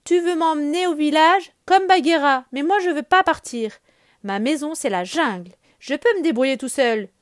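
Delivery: put-on a child refusing to leave home; defiant Mowgli. Mowgli refusing to go to the village, loud and stubborn.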